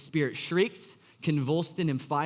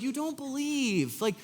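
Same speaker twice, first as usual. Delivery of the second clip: mock retort; 12 semitones higher, much slower than usual. Mocking the fake believer.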